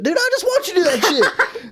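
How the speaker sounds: high-pitched, laughing disbelief; mock incredulous. Clerk confronts customer who dropped fruit cup, incredulous. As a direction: call out the obvious lie in mock outrage.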